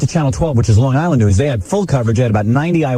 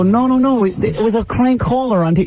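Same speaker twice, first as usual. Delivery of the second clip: mock protest; 11 semitones higher than usual. Retelling his own protest to friend.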